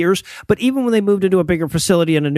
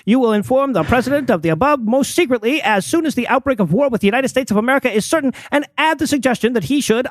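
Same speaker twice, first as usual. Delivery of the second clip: put-on higher, formal old telegram reading; old-timey voice; 5 semitones higher than usual. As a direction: read it like an old newsreel announcer. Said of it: "in an old-timey voice".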